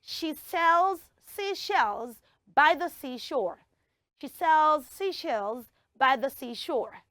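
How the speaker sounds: slow, evenly spaced tongue twister; clear demonstration. Shown as a demonstration. Host shows children the tongue twister, slow and clear. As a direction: take it phrase by phrase, slowly and clearly. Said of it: "So this is it".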